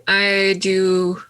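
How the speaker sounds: ordinary talk, nothing marked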